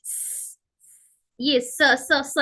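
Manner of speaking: स said as a breathy voiceless hiss; teacher hissing the स sound; hissed s demo. Shown as a demonstration. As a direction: let the air be heard on स. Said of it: "ये हवा की आवाज".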